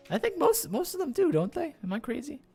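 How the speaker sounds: usual speaking voice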